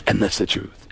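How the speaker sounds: low, measured Baldwin voice; Alec Baldwin impression. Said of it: "he said, he said".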